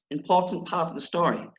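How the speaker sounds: ordinary delivery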